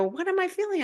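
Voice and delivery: curious inner question; puzzled self-question. Retold or imitated. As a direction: ask yourself in surprise. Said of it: "I stop for a second and I go".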